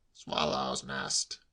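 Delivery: low, very slow confirmation; stolid uncle. A narrator's reading. Uncle insisting on his excuse, stolid. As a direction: repeat it low and drawn out.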